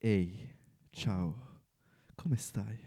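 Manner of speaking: deep, soft Italian greeting; low seductive Italian. Shown as a demonstration. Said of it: "Can you, can you try?".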